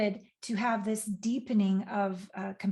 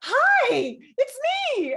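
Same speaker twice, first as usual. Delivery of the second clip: much higher, louder, near tears with awe; overwhelmed divine greeting; 14 semitones higher, 6 dB louder, slower than usual. Retold or imitated. Speaker reliving oneness on the deck, tearful high awe. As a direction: cry out in awe to a divine presence found at last. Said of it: "it was just like".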